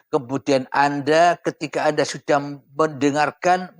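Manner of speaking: ordinary talk, nothing marked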